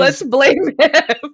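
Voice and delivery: high, loud, drawn-out declaration; laughing, hammed-up finger-pointing; mock accusing. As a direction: stretch it out as a silly, triumphant verdict. Heroine's silly accusation mocked in retelling.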